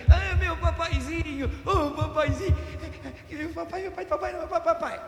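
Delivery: put-on high, pleading little-kid voice; a little child's voice calling papa; child calling daddy. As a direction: play the small child begging for dad.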